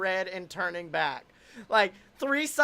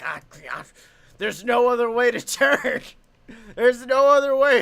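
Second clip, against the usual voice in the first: loud, higher mock helplessness; retold protest; 3 semitones higher, 9 dB louder than usual. Old lady stuck behind car, mock exasperated protest. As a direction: yell it as a flustered old driver.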